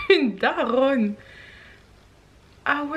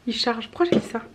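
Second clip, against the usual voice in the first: ordinary delivery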